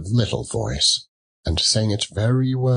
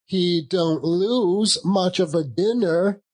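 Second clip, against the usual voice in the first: laughing; 9 semitones higher than usual. Acted. Nephew jokes, laughs at uncle.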